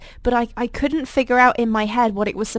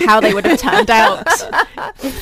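laughing; 4 semitones higher, 8 dB louder than usual. Real, not acted. Joking about [name].

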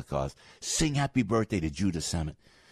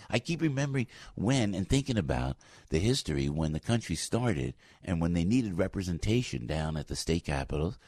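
normal talking voice

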